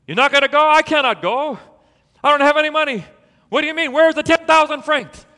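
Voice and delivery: high, loud disbelieving cry; shocked sisters. Retold or imitated. Sisters shocked Babette stays.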